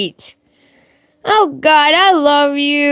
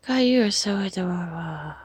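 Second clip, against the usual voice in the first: soft fond coo; 7 semitones lower, 11 dB quieter, faster than usual. Real, not acted. Cooing at a picture of God.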